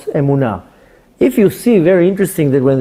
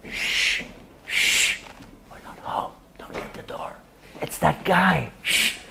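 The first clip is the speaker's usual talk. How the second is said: urgent hushed whisper; 14 dB quieter than usual. Retold or imitated. Parent hushing the family from a knocking visitor, whispered. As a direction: hiss it like hiding from the door.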